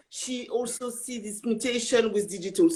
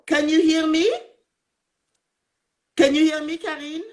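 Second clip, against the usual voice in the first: louder and higher, checking whether the guest can hear; calling out over a bad line; 6 semitones higher, 6 dB louder than usual. Real, not acted. Host calls out to guest on a bad connection, louder and higher. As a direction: call out to someone on a dropping connection.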